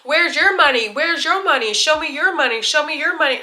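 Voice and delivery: high, insistent demand on repeat; mock yelling. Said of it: "yelling at me".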